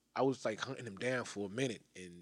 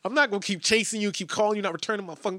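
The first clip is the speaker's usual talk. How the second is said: raised, high-pitched mock threat; aggravated threat; 9 semitones higher, 9 dB louder than usual. Caller retelling his threat to an elusive contact, aggravated. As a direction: retell an exasperated warning, loud and high. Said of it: "I started getting aggravated with him".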